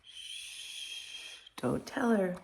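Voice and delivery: whispered hush, then a soft plea; gorilla whispering. Acted. Gorilla hushes the other animals so the wife won't hear, whispered. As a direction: hush it like a secret. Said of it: "the gorilla is going".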